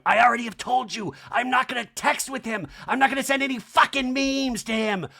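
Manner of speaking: high, loud mock meltdown; hammed-up fed-up outburst; [name] exasperated. [name] at the table swearing off memes, high exasperated mock yell.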